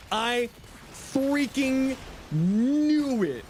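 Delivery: I freaking knew it, stretched out high and slow; drawn-out gloat. Real, not acted. Streamer gloating to chat, slow triumphant drawl. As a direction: savour every word of the told-you-so. Gloating over guessing the trademark.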